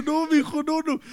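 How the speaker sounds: high pleading "no, no"; a hint of panicked owner; lightly voiced despair; mock desperate plea. Reenacting panic over dying dog.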